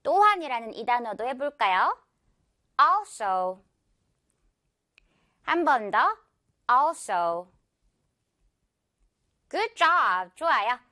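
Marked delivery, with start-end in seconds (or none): 2.8-3.6 also with a strong AL, low falling so; stress on first syllable. Shown as a demonstration. Teacher says it for repetition, front-stressed also. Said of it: "앞 음절의 강세입니다". 6.7-7.5 also with a strong AL, dropping on so; stress on first syllable. Shown as a demonstration. Teacher gives one more model, front-stressed also. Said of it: "앞 음절의 강세입니다".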